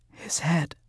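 trembling breathy whisper; shaking voice. A narrator's reading. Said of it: "her voice shaking".

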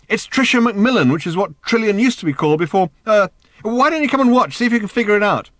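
high, loud, flustered voice; confused calling out. A narrator's reading. Arthur calls to Ford from the next room, high and flustered. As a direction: call through, flustered and baffled.

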